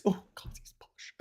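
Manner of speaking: hushed, whispered swooning; hammed-up breathless whisper; mock awe. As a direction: whisper it as if drooling over him. Acting people salivating at posh voice.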